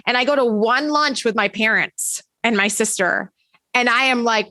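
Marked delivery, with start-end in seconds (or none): none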